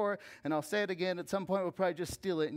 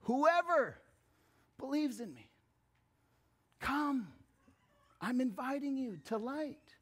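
Jesus' invitation; 7 semitones higher than usual. Retold or imitated. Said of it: "Jesus' last statement to the world".

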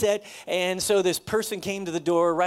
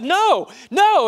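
high, loud mock cry of no; imitating distraught woman; 13 semitones higher, 12 dB louder than usual. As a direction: squeal her refusal, pitched way up. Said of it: "and she said".

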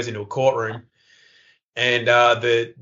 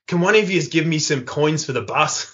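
cheeky Aussie bloke asking for coins, lightly higher; broad Australian; 4 semitones higher than usual. Retold or imitated. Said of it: "the guy goes".

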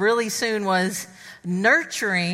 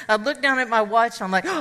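ordinary talk, nothing marked

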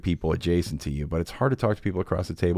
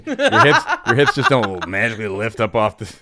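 laughing hard through the words; 4 semitones higher, 6 dB louder than usual. Real, not acted. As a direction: push the words out while cracking up. Laughing rant about stomach sleeping.

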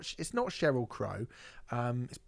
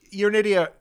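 loud, higher mock scolding; mock angry outburst; 7 semitones higher, 11 dB louder than usual. Fan scolding the singer on request, mock angry shout. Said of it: "Do it again properly".

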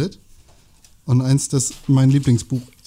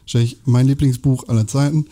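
usual speaking voice

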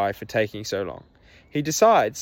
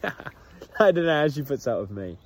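says it again through a real laugh; chuckling repeat; 3 semitones higher than usual. Real, not acted. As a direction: laugh the line out, amused at himself.

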